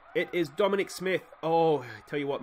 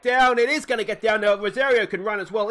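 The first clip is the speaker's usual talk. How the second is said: excited; 6 semitones higher, 9 dB louder than usual. Real, not acted. Hit falling in for a double.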